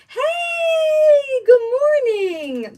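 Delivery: high, loud, drawn-out hello; calling out to viewers; excited greeting. Real, not acted. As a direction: sing out a cheery good morning. Greeting live chat.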